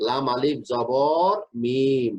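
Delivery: লাম আলিফ জবর মিম spelled very slowly; careful teacher dictation; slow spelling. Shown as a demonstration. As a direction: dictate each letter and its vowel sign. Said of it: "লাম বলতে".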